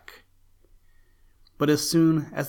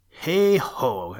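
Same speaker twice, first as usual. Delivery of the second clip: cheerful exclamation; 5 semitones higher, 5 dB louder than usual. A narrator's reading. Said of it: "exclaimed dear Uncle Lucky".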